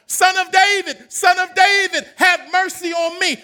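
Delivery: the beggar's cry building from loud to shouting. Acted. Blind beggar crying out to Jesus over the crowd, building to a shout. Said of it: "he got louder and louder".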